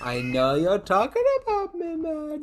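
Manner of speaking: high, drawn-out mock impression; badly faked presidential voice, climbing very high; Trump. Co-host voices the dog thinking aloud, hammed-up impression. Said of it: "you're doing your Trump face".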